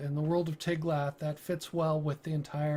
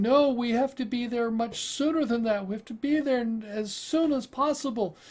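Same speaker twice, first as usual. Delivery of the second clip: higher-pitched insistent woman's plea; urgent pleading; 6 semitones higher than usual. Acted. Frantic passenger urging the captain to hurry, urgent insistence. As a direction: press the point urgently, pitch raised. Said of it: "she's urging the captain".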